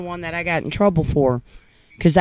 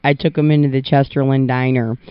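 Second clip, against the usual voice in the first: ordinary delivery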